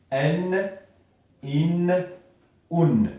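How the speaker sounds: N, in, un said as separate sounds; name each nunation sound slowly for learners; tanwin endings. Shown as a demonstration. Teacher to class, sounding out each ending clearly. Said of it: "Donc ça fait".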